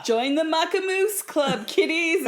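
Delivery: high, bright host calling kiddies; cartoon host inviting children; cartoon kids' show host. Cartoon club host calls kids.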